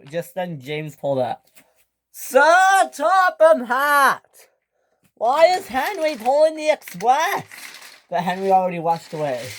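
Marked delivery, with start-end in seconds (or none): 2.1-7.4 loud, high, drawn-out shout; high stretched yell at the boss. Acted. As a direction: call out across the yard in alarm. James shouts arriving.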